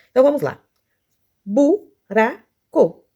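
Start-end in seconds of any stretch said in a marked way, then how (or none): 1.5-3.0 "Buraco" in three slow, raised beats, bu-ra-co; long gaps between syllables of "Buraco". Shown as a demonstration. Teacher showing three syllables, "Buraco" slowly separated. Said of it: "três sílabas".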